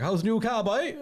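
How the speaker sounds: a hammed-up Cork comedian asking about the car; bad Cork accent. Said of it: "gonna do the accent wrong".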